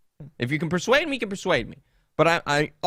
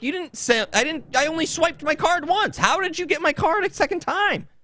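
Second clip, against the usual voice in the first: much higher, exasperated retold complaint; raised, indignant confronting of the salesman; indignant protest. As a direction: replay your outrage at the double charge. Retelling arguing with salesman.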